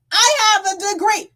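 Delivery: high, loud snooty mimic of the commenter; mocking quote. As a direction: parrot her smugly, nose in the air. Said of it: "You sit up here being a whole fucking Wikipedia".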